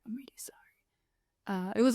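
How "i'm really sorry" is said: unvoiced whisper, barely audible; sheepish whispered sorry; whispered apology. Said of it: "And you were like".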